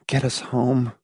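softly, on jangled nerves; low, jangling voice. A narrator's reading. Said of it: "in a low, jangling voice".

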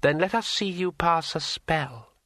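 slow, airy tree spirit voice. A narrator's reading. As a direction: speak like a rustling dryad. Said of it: "said the Dryad".

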